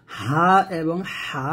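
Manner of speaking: very slow হা and হা, each drawn out; slow contrast of two Arabic হা; slow letter contrast. Shown as a demonstration. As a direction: stretch both হা apart. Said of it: "এই দুটো হরফ অনেক গুরুত্বপূর্ণ".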